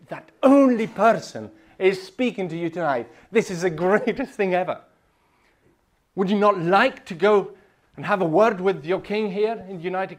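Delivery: loud, high fervent preaching; preaching at full force. Real, not acted. Preacher urging the congregation, loud and fervent. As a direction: proclaim it to the whole hall.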